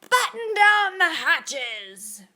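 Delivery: captain roaring in a storm; storm shout. A narrator's reading. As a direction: yell it over the wind. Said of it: "Braidbeard shouted".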